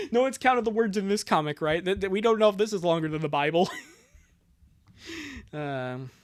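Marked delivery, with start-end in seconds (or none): none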